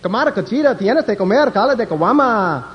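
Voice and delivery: high, rapid mock-Spanish gibberish. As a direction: rattle off fake Spanish in a high voice. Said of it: "And he said".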